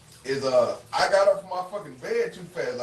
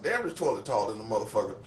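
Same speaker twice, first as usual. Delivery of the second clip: mild retold mutter; 6 semitones lower, 7 dB quieter, faster than usual. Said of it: "I said".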